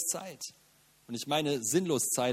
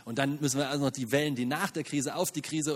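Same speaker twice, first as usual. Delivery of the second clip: normal talking voice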